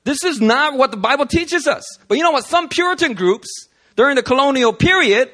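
growing preacher fervour; preaching. Real, not acted. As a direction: build the sermon toward passion. Preaching builds.

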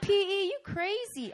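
high laughing tease; amused friends teasing. Retold or imitated. People laughing at her story.

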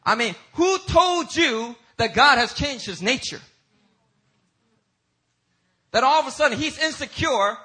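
loud, high, indignant rhetorical questioning; challenging preaching. Real, not acted. Preacher challenging doubters of God's supernatural nature, impassioned preaching. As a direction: fire the challenge at the congregation at full force.

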